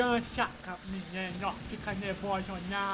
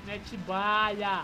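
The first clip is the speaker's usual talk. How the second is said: drawn out; 3 semitones lower, much slower than usual. Acted. Urging friend to shoot.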